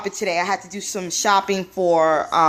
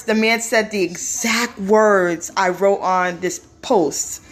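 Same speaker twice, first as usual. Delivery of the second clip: usual speaking voice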